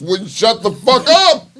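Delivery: high, slow, drawn-out outburst; mock-exasperated yell. Host reading a murder headline, exasperated yell. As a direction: yell it as a punchline. Host yells the story's punchline.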